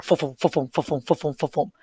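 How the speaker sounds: rapid muffled repeated fums; imitated toilet-paper roll. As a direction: mimic a roll spinning in short soft bursts. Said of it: "you hear the toilet paper".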